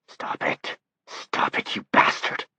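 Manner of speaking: strained whispered plea, breaking; desperate whisper. A narrator's reading. Dussault begging Johnny to stop, desperate whisper. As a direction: whisper it like it hurts.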